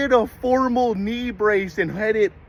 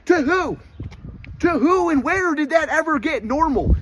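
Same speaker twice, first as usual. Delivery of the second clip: indignant; 6 semitones higher than usual. Real, not acted. Answering Burger King's "that's normal", indignant and raised. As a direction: push back hard at the claim.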